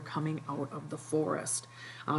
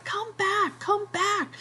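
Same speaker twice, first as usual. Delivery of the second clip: very high, calling after the ship; desperate yell. Retold or imitated. Ralph yelling after the departing ship, very high and desperate. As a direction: Ralph screaming at a ship that won't turn. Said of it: "then he starts yelling".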